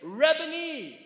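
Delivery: high, loud and slow; cried out. Acted. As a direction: cry the word out in joy. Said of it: "cried out in Aramaic".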